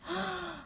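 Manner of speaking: quick, high sharp intake of breath; Mummy Bear gasping at her bowl. A narrator's reading. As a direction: gasp in shock like Mummy Bear. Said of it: "And Mummy Bear gasped".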